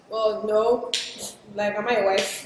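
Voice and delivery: laughing, playful brush-off; teasing with a laugh. Retold or imitated. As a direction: tease your friend and laugh it off. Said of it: "And I say".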